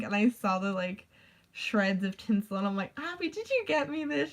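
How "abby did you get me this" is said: higher-pitched, eager mock question; retold excited question. Asking a friend about her gift, retold with high-pitched excitement.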